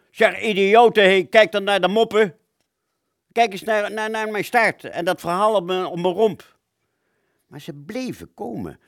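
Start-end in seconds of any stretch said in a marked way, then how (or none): 0.1-6.6 loud high shout at the enemy. Acted. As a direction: yell across at the pilots. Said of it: "ik schreeuwde". (roept luid naar de Duitse piloten)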